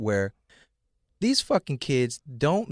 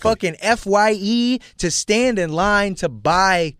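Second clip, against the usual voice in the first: emphatic rant; 5 semitones higher, 5 dB louder than usual. Real, not acted. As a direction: hammer out each hardship. Emphatic rant about old days.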